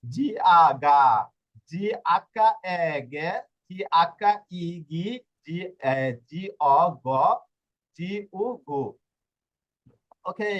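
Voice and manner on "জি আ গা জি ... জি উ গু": slow, spelling out each syllable; a teacher spelling out syllables slowly for the class; slow spelling demo. Shown as a demonstration. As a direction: sound each part out slowly for learners. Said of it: "এরপরে গ কিরকম হয় দেখুন".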